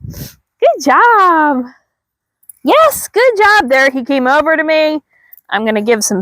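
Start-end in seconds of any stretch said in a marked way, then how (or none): 0.1-3.9 rising, higher excited dog praise; praising the dog. Real, not acted. Trainer praises her dog for coming over, climbing excited praise. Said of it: "praise him for that".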